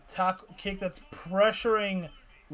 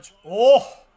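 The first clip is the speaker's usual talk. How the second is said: sudden loud startled oh; startled shout at a big hit; surprised outcry; 4 semitones higher, 17 dB louder than usual. Real, not acted.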